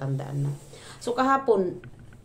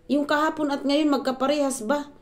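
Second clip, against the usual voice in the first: higher, louder, arguing; indignant question; 8 semitones higher, 3 dB louder than usual. Retold or imitated. Vlogger arguing with her mother-in-law, higher and sharp. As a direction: argue back pointedly. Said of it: "sabi ko naman".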